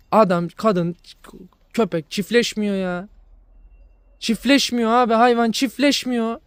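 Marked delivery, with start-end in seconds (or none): 4.2-6.4 exasperated rant. Real, not acted. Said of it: "sonradan tepem attı biraz".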